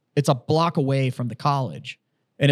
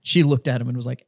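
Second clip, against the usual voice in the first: ordinary talk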